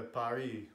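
Paris said as pah-REE; native pronunciation. Shown as a demonstration. Friends having fun naming places, native pronunciation. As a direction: say Paris the French way, silent s. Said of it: "in their native languages".

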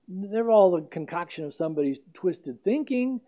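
normal talking voice

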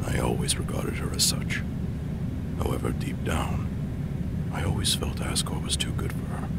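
hushed whispered inner monologue. Acted. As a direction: breathe it out like a secret thought. Whispered private thoughts at tea.

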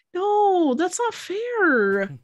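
high, slow mock whine; whiny protest. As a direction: whine it like a kid. Mock protest at spoiler refusal.

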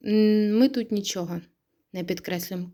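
ordinary talk

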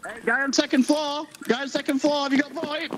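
high-pitched hail to someone upstairs; calling out. Real, not acted. Player hails guy on second floor, calling out.

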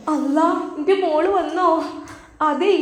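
put-on high, bright-pitched old mother calling out; a mother thrilled her girl is home; mother's joyful greeting. Mother welcoming her daughter home, high excited call.

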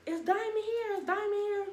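high, sing-song imitation of the girl calling out; mimicking the girl. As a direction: pitch it way up like the eager girl asking. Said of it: "She was like".

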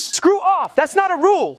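very high-pitched indignant retort; indignant protest. Acted. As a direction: squeal back at the accuser. Protesting a cheating accusation.

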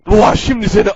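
usual speaking voice

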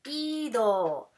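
"Ido" with a long, leaned-on I; drawn-out, accented I in "Ido"; stress on the í. Shown as a demonstration. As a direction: stretch the first vowel of "Ido" to show the accent. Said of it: "tienen acento en la I".

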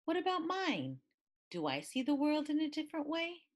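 high, quick excited questions; bursting to know her own fortune; eager wonder. A narrator's reading. Child wondering about her own fortune, excited.

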